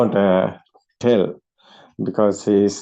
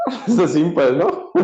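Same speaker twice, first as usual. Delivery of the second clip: amused through a laugh; light laughing question; 7 semitones higher than usual. Real, not acted. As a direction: chuckle the words out. Laughing at simplicity.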